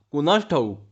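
plain reading voice

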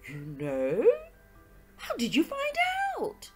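incredulous question leaping high; astounded friend's outburst; astonished. Acted. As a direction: react in amazement to a revelation. Hastings amazed at the revelation.

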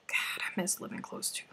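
unvoiced longing whisper. Real, not acted. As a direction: breathe it out, no voice.

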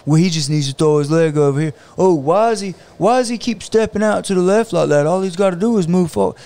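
hammed-up couch expert second-guessing the fighter; armchair critic. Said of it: "Anybody can look at a U F C fight and go".

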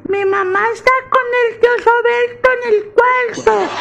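aguda y lenta de niño pequeño; voz de niño. Acted. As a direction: habla como un niño inocente. Niño habla con papá. (niño muy agudo contando a su papá)

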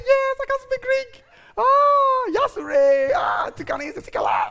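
shouted high, proud and loud; loud Greek boast. Retold or imitated. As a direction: belt it out like showing off. Showing off Greek at interview.

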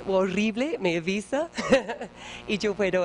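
ordinary talk

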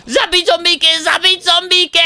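high battle cry; shouted command. Pretend. Player fires his hacker command at zombies, shouted battle cry. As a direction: yell the order out, pitched right up.

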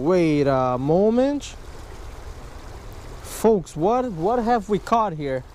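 ordinary talk

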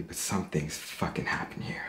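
low-volume, breath-heavy confiding; hushed, haunted. Acted. Man telling viewers something has happened, hushed. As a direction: drop to a near-whisper.

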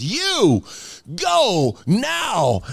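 high, forceful drawn-out order; commanding angel. Acted. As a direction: banish the demon, each word punched out. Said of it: "he points at the demon".

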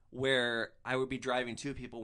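ordinary talk, nothing marked

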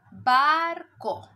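barco split into bar-co, said loudly; teacher counting the beats of barco; syllable by syllable. Shown as a demonstration. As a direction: clap out barco slowly, one beat per syllable. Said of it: "Y contamos".

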